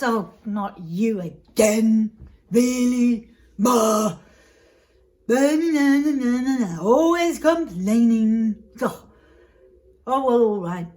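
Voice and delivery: loud, drawn-out groan of complaint; exasperated brother. Acted. Rich brother fed up with begging brother, exasperated. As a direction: grumble loudly and grudgingly give in.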